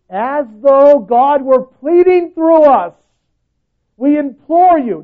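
verse belted out high, loud and slow; preached loud. Shown as a demonstration. Pastor repeats the verse to the church, loud preaching. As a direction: proclaim it so the church hears. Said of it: "Hear me".

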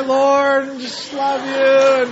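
mock-fervent praise, held on one drawn-out level tone; fervent worshiper. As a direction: imitate someone lost in worship, slowly.

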